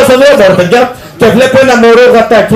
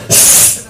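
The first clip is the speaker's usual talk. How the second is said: unvoiced hiss to silence; quiet shushing; 7 dB quieter than usual. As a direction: shush him sharply. Shushing friend.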